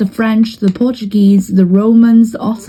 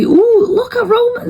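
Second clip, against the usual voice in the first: much higher, slow delighted ooh at the map; high, drawn-out excited gasp of interest; slower than usual. Real, not acted. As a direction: squeal it out as the Romans surge. Sudden exciting move in game.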